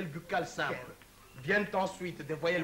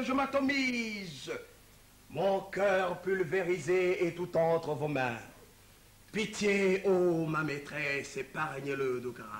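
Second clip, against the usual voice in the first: passionate love declamation. Acted. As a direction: play an overblown romantic lover reading his own verse. (déclamation amoureuse grandiloquente et théâtrale)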